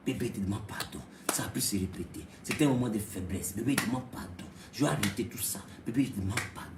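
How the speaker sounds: very low, soft plea; husband softly begging his wife's forgiveness; begging pardon. Acted. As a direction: murmur a tender apology.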